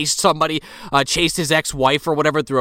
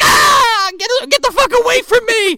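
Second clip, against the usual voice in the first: high, loud panicked yell to get away; mock terrified scream; 5 dB louder than usual. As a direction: shriek at him to back off, hammed up. [name] shoving off the attacker.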